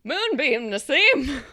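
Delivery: loud, high excited shout; excited cheer. Real, not acted. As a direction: cheer the name out.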